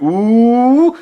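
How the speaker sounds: long, loud, rising "ooh"; drawn-out ooh, sliding up high; imitated crowd "ooh". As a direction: mimic a whole school's taunting ooh. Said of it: "all the students and all the teachers went".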